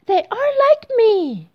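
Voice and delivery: very high, loud delighted cry; joyful amazement. Acted. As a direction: exclaim it in thrilled discovery. Thumbelina finds children like her.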